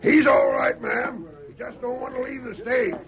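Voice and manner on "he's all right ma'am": louder, higher call to calm her; loud reassurance. Acted. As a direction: shout over her to settle her. Outlaw shouts to calm girl.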